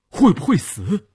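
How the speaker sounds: high, pressing question; urgent demand. A narrator's reading. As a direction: demand an answer from the doctor. Officer presses doctor about wounded man. (急切逼问)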